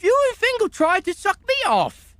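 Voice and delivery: high-pitched, heated accusation; indignant outburst. Acted. Witness turns on the officer in court, indignant high outburst. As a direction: fling the accusation back, voice shooting up.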